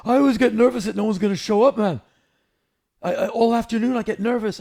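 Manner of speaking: high, loud, fast nervous rock star. Retold or imitated. [name] voiced admitting nerves.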